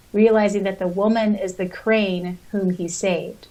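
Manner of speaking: ordinary delivery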